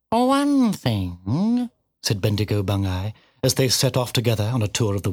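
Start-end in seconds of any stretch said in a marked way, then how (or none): 0.1-1.7 high, drawn-out cat voice; bitten-off words. A narrator's reading. Cat curtly questioning the piglet, words bitten off. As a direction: bite each word off and spit it out. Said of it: "seemed to bite off its words and spit".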